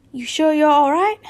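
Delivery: concerned Hagrid. Acted. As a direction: ask with loud concern as Hagrid. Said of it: "Hagrid looked concerned".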